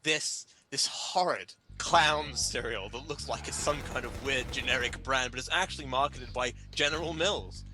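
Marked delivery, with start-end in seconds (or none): none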